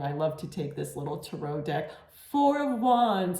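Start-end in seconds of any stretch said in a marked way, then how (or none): 2.3-3.4 excited. Real, not acted. Joyfully announcing the card.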